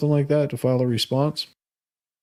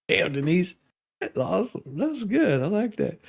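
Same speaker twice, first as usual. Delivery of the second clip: delighted reaction; 4 semitones higher, 4 dB quieter than usual. Real, not acted. Delighted reaction to a chat comment.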